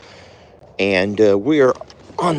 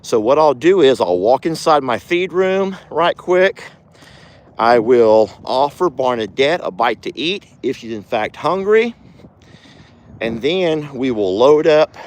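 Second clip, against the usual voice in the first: ordinary delivery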